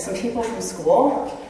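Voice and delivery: slow, you're-so-stupid answer; dismissive son. Acted. Son answering parent's question, slow and dismissive. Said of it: "You are so stupid for asking me".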